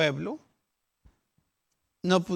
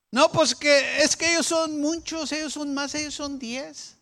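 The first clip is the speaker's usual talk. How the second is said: high whiny excuse; 7 semitones higher, 5 dB louder than usual. Retold or imitated. Voicing the complaining people.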